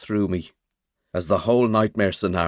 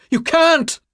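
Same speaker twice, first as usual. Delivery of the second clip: desperate shout; 7 dB louder than usual. Acted. Mick blocking the bedroom door.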